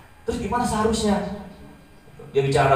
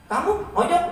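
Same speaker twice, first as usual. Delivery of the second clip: surprised teacher question; 12 semitones higher than usual. Retold or imitated. Teacher questions student, voiced high.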